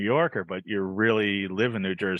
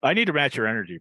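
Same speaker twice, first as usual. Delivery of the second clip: ordinary delivery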